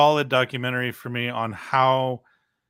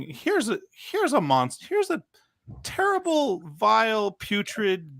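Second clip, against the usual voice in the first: much higher, hammed-up monster-show announcer; mock horror presenter; 9 semitones higher than usual. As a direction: sell him like a creature feature.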